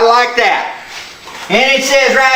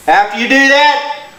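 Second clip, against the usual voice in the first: ordinary talk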